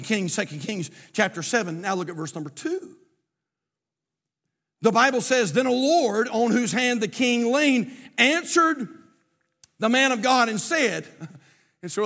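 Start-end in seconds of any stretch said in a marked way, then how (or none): 4.8-11.1 loud, raised scripture reading; higher, emphatic Bible reading; preaching. Acted. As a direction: proclaim the verse from the pulpit.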